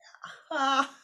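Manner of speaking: high, loud derisive laugh burst; mocking laugh. Fellow Swamis laughing at the young Swami, derisive laugh. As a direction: laugh her down. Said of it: "They all started laughing".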